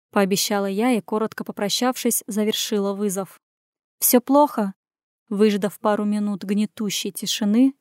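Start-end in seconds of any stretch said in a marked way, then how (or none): none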